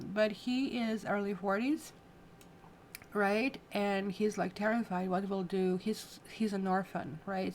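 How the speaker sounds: usual speaking voice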